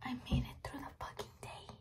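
soft unvoiced whisper. Real, not acted. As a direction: whisper so nobody wakes up. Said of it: "I'm talking like this because everybody's asleep already".

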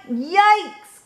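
high startled yelp; alarmed cry. A narrator's reading. Froggy wobbling on his bike, high cry. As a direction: cry out high as if about to fall. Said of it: "cried Froggy".

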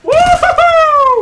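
high, slow, loud whooping shout; wild whoop. Real, not acted. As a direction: howl a victory whoop. Cheering during fight.

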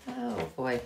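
plain reading voice

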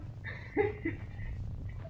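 faint high-pitched tittering; high giggle. Real, not acted. Player running out of laughter, faint high giggle. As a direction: let the laughing fit tail off in a soft, high giggle. Hysterics tailing off.

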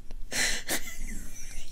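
high, silent, wheezing laugh; helpless crying laugh. Real, not acted. Cracking up over fan fiction, helpless crying laugh. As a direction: laugh until it turns to tears.

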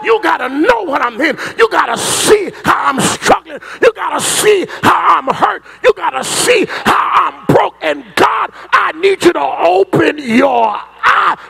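pleading with God in a building shout; impassioned acted prayer from the pulpit; full-force preaching. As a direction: hammer each repeated demand, rising to a climax. Preaches Solomon's plea at full force.

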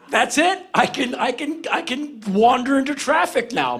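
high-pitched, stuttering overjoyed declaration; mock elation. Fan just hugged by his idol, high giddy declaration. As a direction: gush it high, tripping over the start. Elated fan after idol's hug.

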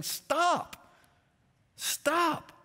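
high, emphatic warning with a pause between; God's urgent command. Retold or imitated. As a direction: voice God halting someone in danger. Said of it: "That's God saying".

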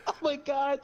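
high, laughing gasp; helpless laughter. Real, not acted. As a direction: say it through real, helpless laughing. Overcome by laughter at own story.

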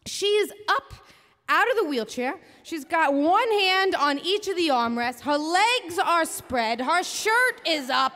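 ordinary delivery

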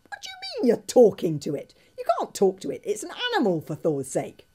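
high, loud, incredulous protest; exasperated Fishlegs. Acted. Fishlegs scolds Hiccup, loud and high-pitched.

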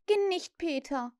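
put-on high, imploring girl; pleading. A narrator's reading. Wendy begs Peter to stay, pleading. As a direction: beg him in a high voice. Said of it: "flehte sie ihn an".

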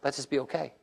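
a quiet plea to God, held back; hushed inner prayer. Retold or imitated. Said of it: "I'm going".

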